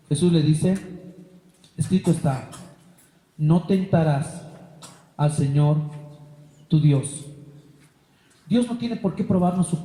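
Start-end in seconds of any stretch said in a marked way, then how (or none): 1.8-7.2 solemn Jesus. Acted. Jesus solemnly answering Satan's temptation, low and grave. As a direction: pronounce it like scripture, deep and firm.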